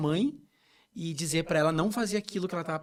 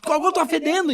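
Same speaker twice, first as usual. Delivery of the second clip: half-laughing retold annoyance, higher and louder; irritated protest; 10 semitones higher, 5 dB louder than usual. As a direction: complain to a friend who keeps hugging you. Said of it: "E eu ficava irritado, bravo". (protesto irritado, mais agudo e mais alto)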